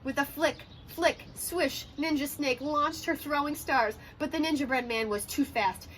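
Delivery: usual speaking voice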